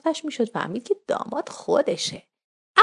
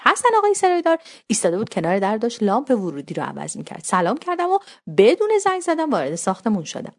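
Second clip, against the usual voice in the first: ordinary delivery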